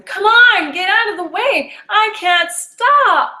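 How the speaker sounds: high, loud frantic shout, slowing at the end; panicked shouting to a man who won't move; desperate yelling. Retold or imitated. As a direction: plead at the top of your voice. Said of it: "how many times I'd yell at him".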